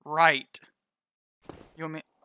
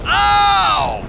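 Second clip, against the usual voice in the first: high shrieking yelp; terrified horror victim; 11 semitones higher, 8 dB louder than usual. Real, not acted. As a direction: yelp in sudden fright. Sudden fright.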